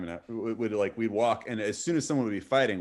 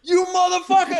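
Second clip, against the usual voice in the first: much higher and louder enraged cry; furious yell; 9 dB louder than usual. Retold or imitated. Attacker screaming at the guy who ducked, furious yell. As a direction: bellow it like a man gone berserk.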